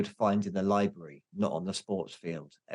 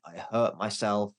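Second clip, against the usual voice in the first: normal talking voice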